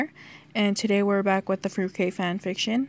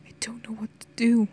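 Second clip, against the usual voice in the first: hushed, lost murmur; half-whispered despair; helpless whisper; 2 semitones higher than usual. A narrator's reading. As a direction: whisper it as if at a loss. England helpless at brother's bedside.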